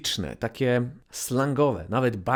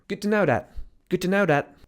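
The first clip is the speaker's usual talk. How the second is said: higher "Good to know that" rattled off; quick casual phrase; 6 semitones higher, 4 dB louder than usual. Shown as a demonstration. Teacher testing students with a fast "Good to know that". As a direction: toss it off fast. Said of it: "Co ja powiedziałem?".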